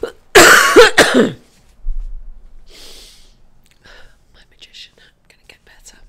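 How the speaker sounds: hoarse whisper after coughing. Real, not acted. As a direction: whisper it to the hat. Coughing then whispered wish.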